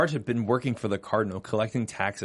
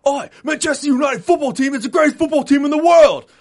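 much higher, much louder Cockney football chant; Vinnie Jones Cockney; 12 dB louder than usual. Retold or imitated. The host quotes Vinnie Jones from Eurotrip in a shouted Cockney voice. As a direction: bellow it like a rowdy English fan. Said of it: "What does he say in, uh, Eurotrip?".

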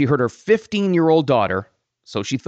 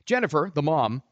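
ordinary talk

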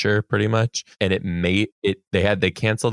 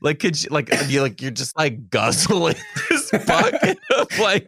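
high disgusted laughter; 8 semitones higher than usual. Real, not acted. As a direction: laugh through the words, pitched high. Laughing at gross image.

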